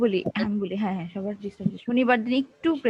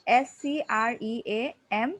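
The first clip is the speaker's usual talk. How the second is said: slow spelling; much slower than usual. Shown as a demonstration. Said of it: "স্ক্রিমটা জিজ্ঞেস করেছিলাম।".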